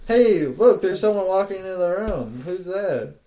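high-pitched, play-acted surprise; hammed-up excited cue; mock excited announcement. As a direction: announce a sudden arrival, loud at first. Setting up a bit for friend.

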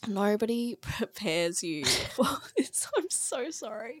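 really crying, words broken by sobs; tearful. Real, not acted. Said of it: "I'm crying".

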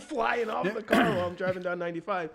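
loud, high-pitched mock outburst; alarmed retort. Driver retorting to mom's partner about loose wheels, loud alarmed protest. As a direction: protest loudly, pitch shot right up.

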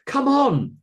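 very high, loud shout; top-of-voice shout. A narrator's reading. Carolina running for the exit, full shout. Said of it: "shouted at the top of her voice".

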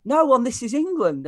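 high, loud insistent no; imitating an excited fan; eager correction. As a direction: set him straight with excitement. Stranger corrects him loudly.

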